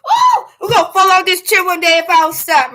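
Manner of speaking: loud and laughing; laughing shout. Real, not acted. Laughing at own silliness.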